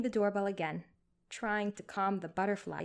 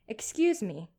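louder, firmer call through the door; 2 semitones higher, 5 dB louder than usual. A narrator's reading. Nurse calling firmly through a closed door, firm. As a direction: call out with more resolve. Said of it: "she called out more firmly".

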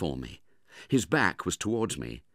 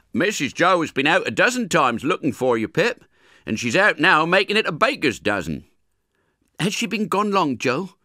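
put-on higher, louder character voices for Joe and young Pip; voiced Joe and Pip; 10 semitones higher, 8 dB louder than usual. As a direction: play the kitchen chat between the blacksmith and the boy.

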